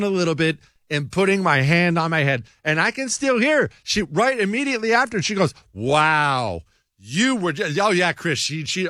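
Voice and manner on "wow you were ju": drawn-out, unimpressed wow, quoting her; imitating her reproach. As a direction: mimic her let-down tone. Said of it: "that was the exact tone of voice".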